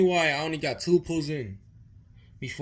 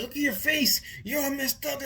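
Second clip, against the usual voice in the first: much higher, alarmed mother's voice; high, upset mom seeing his injuries; worried mom; 11 semitones higher than usual. Retold or imitated. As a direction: voice his mom gasping at his face.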